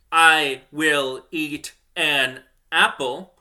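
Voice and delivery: "I will eat an apple" fully spelled out, slowly; full careful form. Shown as a demonstration. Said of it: "I don't say".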